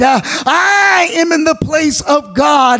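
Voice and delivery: sermon cadence building to a cry; full-force preaching. Acted. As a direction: preach it to the whole church.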